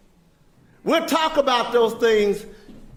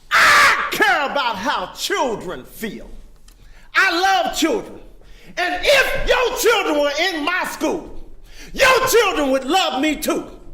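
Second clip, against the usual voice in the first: passionate shout; 5 semitones higher than usual. Real, not acted. Preacher builds to passionate shout.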